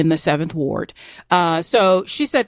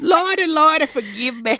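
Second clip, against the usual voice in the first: much higher, pleading mock Southern cry; hammed-up Southern plea; 8 semitones higher than usual. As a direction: beg the Lord in a hammed-up Southern accent.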